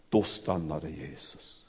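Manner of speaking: low, slow, soft announcement; hushed. Shown as a demonstration. Preacher dwelling on the moment Jesus stops, low and soft. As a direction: let the words fall quietly and slowly. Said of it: "målar en sån underbar gudsbild".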